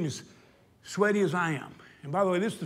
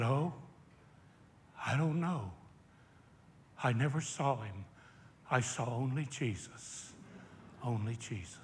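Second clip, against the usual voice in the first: hushed awe; 7 semitones lower, 8 dB quieter than usual. Retold or imitated. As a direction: let it fade out in quiet awe, as if still dazed. Awed listener recalls the sermon.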